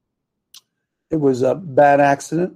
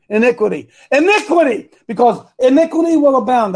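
high, triumphant repetition; real eureka moment, pitch jumping up; excited recall; 8 semitones higher, 3 dB louder than usual. Real, not acted. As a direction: seize on the found word, climbing higher. Has recalled the missing word.

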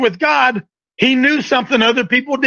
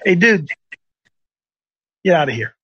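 dismissive Lord; 8 semitones lower than usual. Retold or imitated. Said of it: "He said".

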